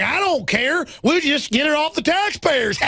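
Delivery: high, slow sneering bureaucrat; sneering high parody of a cop; mocking cop voice. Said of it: "He's like".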